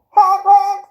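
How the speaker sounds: high mock clown honk; silly clown honk. Clown honking.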